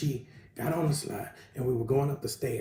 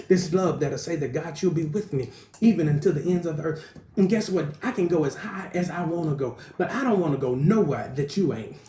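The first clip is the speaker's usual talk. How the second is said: raised, higher and louder preaching climax; fervent preaching; 4 semitones higher, 4 dB louder than usual. Acted. Preacher praising God's love to congregation, impassioned high preaching. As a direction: lift the praise, preaching hard.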